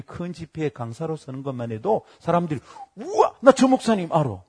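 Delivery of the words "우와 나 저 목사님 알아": high, loud mock awe; wowed fan showing off; excited boast. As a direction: imitate a thrilled name-dropper. Imitated people boasting of knowing pastor.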